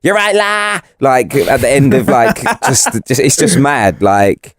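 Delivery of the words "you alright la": high-pitched Scouse greeting; thick, exaggerated Scouse; Scouse accent. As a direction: imitate a very broad Liverpudlian, pitched up. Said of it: "the more kind of Scouse it is".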